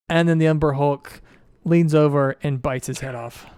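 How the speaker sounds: usual speaking voice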